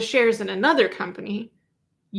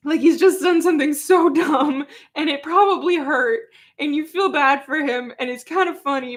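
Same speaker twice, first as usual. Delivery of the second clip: laughing; 5 semitones higher, 3 dB louder than usual. Real, not acted. Laughing about dumb dog.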